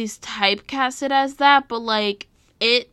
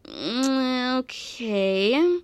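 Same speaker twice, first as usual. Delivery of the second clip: drawn-out, unconvinced okay; hesitant hum into a dragged-out, questioning okay; doubtful reaction; much slower than usual. Retold or imitated. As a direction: stretch it out, not quite sold. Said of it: "elements that I was like".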